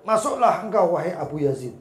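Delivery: loud, high summons; booming call to a visitor. Retold or imitated. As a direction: shout it from inside the house. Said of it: "Sudah diteriaki dari dalam".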